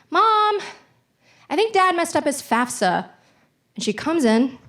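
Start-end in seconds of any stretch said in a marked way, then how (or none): 0.1-3.1 put-on innocent kid calling mom. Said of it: "I was just like".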